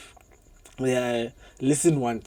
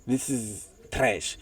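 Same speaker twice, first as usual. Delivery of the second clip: dismissive parent; 5 semitones lower, 11 dB quieter, much slower than usual. Retold or imitated. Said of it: "and says".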